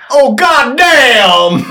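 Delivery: loud, high, drawn-out holler; hammed-up slow yell for a joke; mock outburst. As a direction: holler it big, stretching the words. Mock outburst, then just kidding.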